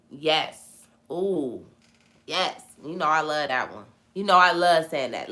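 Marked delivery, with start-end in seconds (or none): none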